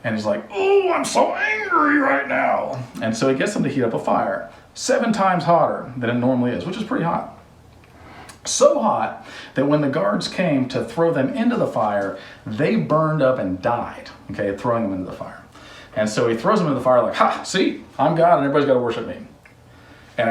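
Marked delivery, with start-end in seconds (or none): 0.5-2.8 high, slow, hammed-up fury; mock angry king. King to the three men, hammed-up high angry whine. 17.1-19.2 mock gloating. King gloating over the fire.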